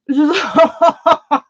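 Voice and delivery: loud, high burst breaking into tears; tearful outburst. Real, not acted. Streamer overcome with gratitude to viewers, tearful outburst. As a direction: let a real sob burst out, high and loud. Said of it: "I'm gonna cry".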